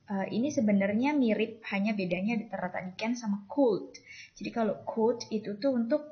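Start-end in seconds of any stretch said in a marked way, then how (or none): none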